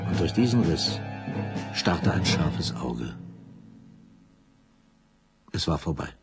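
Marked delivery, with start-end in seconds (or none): none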